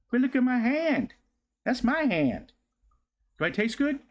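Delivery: high-pitched playful pet talk; high doggy-talk. Real, not acted. Playing with the licking dog.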